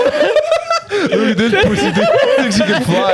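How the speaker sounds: high-pitched heckle through laughter; mock hater jeer. Playing a hater heckling a man who can fly, mock jeer. Voicing haters jeering at a flier.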